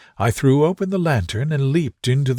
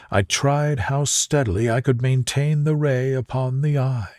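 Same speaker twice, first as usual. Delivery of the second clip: usual speaking voice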